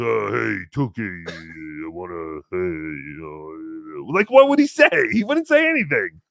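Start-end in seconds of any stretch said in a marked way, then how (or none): 0.0-4.1 low, slow, trailing imitation; John. Imagining an approach at the convention, low mock impression. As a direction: mimic him dragging out a low, soft greeting. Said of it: "If John comes up to me and goes".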